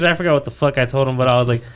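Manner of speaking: usual speaking voice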